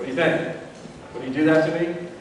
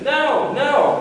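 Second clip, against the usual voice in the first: put-on much higher childish refusal; imitating a resistant kid; 12 semitones higher than usual. Resistant kid refusing to try the food, much higher whiny no. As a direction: whine no like a picky toddler.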